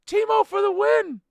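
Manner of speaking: high, loud shout from the stands; yelling. Retold or imitated. Fan on his feet screaming for Timo, high and loud. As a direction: chant the name as loud as you can. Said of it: "I'm yelling".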